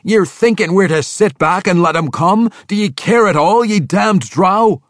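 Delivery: high, loud, slowing insult; furious young dwarf. Acted. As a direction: spit out damned drow. Rollo rages.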